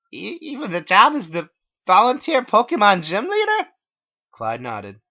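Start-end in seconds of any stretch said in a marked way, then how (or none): 0.1-3.7 very high, loud stammering whine; shrill heartbroken question; dismayed whine. Acted. Narrator dismayed.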